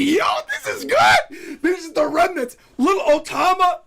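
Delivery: losing it with excitement; overjoyed fan yelling at the screen; hyped shout. Real, not acted. Hyped reaction to the scene.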